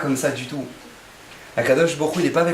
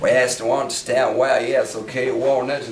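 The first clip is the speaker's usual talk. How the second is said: mock English; 4 semitones lower, slower than usual. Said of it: "Ils appellent Bill Gates et disent".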